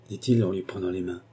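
plain reading voice

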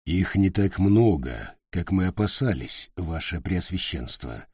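low, soft confidential report; speaking quietly. A narrator's reading. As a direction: say it close and hushed. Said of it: "капитан негромко заговорил". (негромко)

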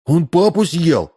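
put-on slow, high child's voice; high-pitched, wide-eyed kid's question; little boy asking. A narrator's reading. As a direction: ask it as a small boy, pitched well up. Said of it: "спросил Максимка".

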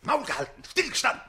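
furious shout at the hiding page; barked command. Acted. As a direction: bark it like a drill order. Count barks at the page.

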